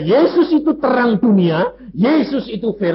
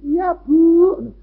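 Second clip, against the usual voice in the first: imitating a sweet maid, higher and louder; soft-voiced maid; 11 semitones higher, 5 dB louder than usual. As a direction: put on a sweet obedient maid voice. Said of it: "suaranya si halus". (menirukan suara pembantu yang halus, lebih tinggi)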